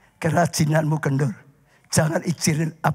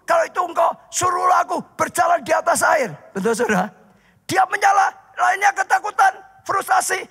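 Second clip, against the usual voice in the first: preaching at full force; 12 semitones higher than usual. Acted. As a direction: drive the story home to the congregation. Preaching Peter walking on water.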